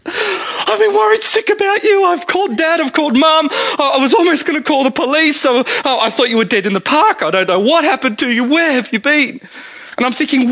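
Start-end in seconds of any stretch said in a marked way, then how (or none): 0.1-9.4 high, teary, half-laughing wife; wife's tearful worry. Retold or imitated. Wife in tears greeting late husband, mock-panicked high. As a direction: mimic her panicked worry, pitched way up. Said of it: "and she said".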